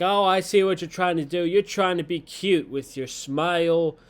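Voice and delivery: hammed-up confrontational jab; mock accusing. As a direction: imitate a hammy promo, fast at the start. Imitating Becky's hostile wrestling promo.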